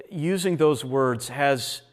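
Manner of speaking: usual speaking voice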